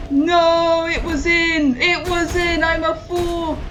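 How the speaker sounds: high, loud drawn-out wail of defeat; anguished wail. Real, not acted. Player who just missed the hole, anguished wail. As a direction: wail at the near miss. Near miss in the game.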